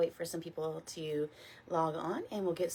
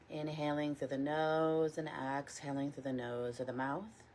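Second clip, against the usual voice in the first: guided meditation. Real, not acted. Teacher leading a morning body scan, calm guided meditation. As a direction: guide them gently, unhurried, letting each phrase settle.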